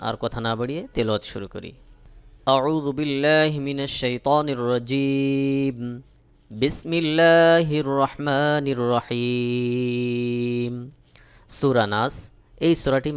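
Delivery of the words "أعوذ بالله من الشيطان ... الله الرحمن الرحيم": slow, drawn-out tajweed chant; measured melodic tilawat; Quranic recitation. Acted. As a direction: intone it slowly like a Quran reciter. Recites istiadha and basmala.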